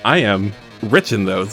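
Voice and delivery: normal talking voice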